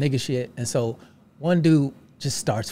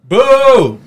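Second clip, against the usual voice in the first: loud, high booing crowd; mock crowd boo; 8 semitones higher, 9 dB louder than usual. Comedian imitating the Cleveland crowd booing loudly.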